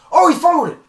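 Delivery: loud, high burst of surprise; excited cry. Real, not acted. Commentator reacting to a dropped ball, excited yell. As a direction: yell it like a commentator seeing a turnover.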